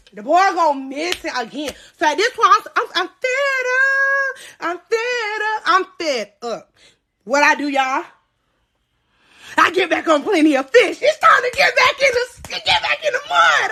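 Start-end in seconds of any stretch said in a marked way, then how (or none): 2.5-6.7 slow hammed-up sing-song cry; mock wailing. Dramatic exasperation. 11.0-13.7 much higher mock battle cry; hyped shout. Hyped declaration.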